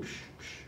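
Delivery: hushed whooshing "psh" twice; guillotine noise. Retold or imitated. Teacher imitating a guillotine execution, soft blade swish. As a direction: imitate a falling blade with unvoiced puffs.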